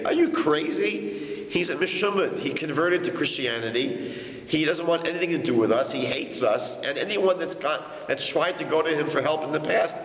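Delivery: high, startled "are you crazy" protest; alarmed disbelief. Retold or imitated. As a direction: warn him off in shocked alarm. Friends alarmed at the idea.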